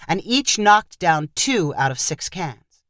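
plain reading voice

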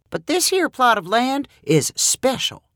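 put-on high, whiny old farmer; whiny fiddle voice. A narrator's reading. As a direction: whine it like a fiddle. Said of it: "in a voice that sounded like a whiny fiddle".

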